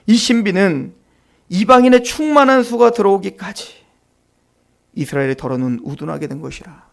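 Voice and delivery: low, soft, measured reading of the verse; scripture reading. Acted. Pastor reads Romans to the congregation, low and solemn. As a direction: read the verse solemnly and quietly.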